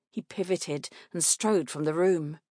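ordinary delivery, nothing marked

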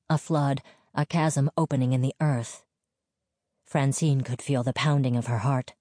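ordinary delivery, nothing marked

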